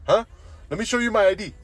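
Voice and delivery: high, loud, insistent mock-accent voice; hammed-up pushy coworker impression; imitated Mexican coworker. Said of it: "and he goes".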